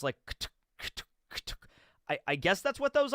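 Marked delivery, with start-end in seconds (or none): 0.3-1.5 whispered clicking footstep noises; mouth-made footstep sound effect; footstep clicks. Shown as a demonstration. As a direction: tap out the footsteps with your tongue. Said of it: "footsteps coming down from upstairs".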